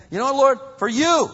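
high, loud cry to the Lord; a believer crying out to the Lord, high and loud; fervent cry. Acted. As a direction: cry out in surrender. Fervent prayer acted.

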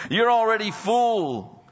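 drip sarcasm on every compliment; preacher voicing Paul's sarcasm at proud church; sarcastic. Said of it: "he begins this kind of sarcastic".

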